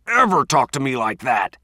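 sharp angry bark; furious yell. Acted. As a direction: shout him down. Chief yelling in the fight.

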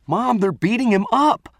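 high, loud alarmed cry; panicked cry. Acted. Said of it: "I cried".

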